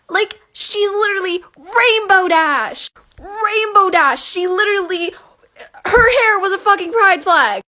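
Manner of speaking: high, loud gushing; excited shouting. Real, not acted. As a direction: gush with fan joy. Excited fan outburst.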